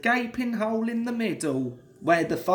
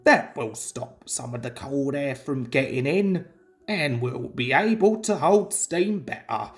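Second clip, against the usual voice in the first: low, steady driver giving orders; calm urgency, a working man's instructions; driver's orders; 6 semitones lower than usual. A narrator's reading. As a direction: driver calmly orders the fireman to plug the hole. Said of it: "he told the fireman".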